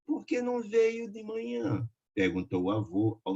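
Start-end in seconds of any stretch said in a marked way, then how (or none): 0.1-1.9 lightly voiced old grandfather. A narrator's reading.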